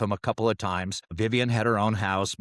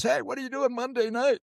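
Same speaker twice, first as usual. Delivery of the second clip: high put-on old Dad voice; old man voice; 13 semitones higher than usual. Son mimics dad's phone call, old man voice. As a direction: croak it out like an old father. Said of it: "that's how he talks".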